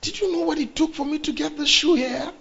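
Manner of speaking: high, stunned disbelief; mock outrage at his wife's thanks; husband imitating his own shocked reaction; incredulous what. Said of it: "I was like".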